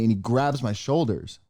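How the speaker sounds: normal talking voice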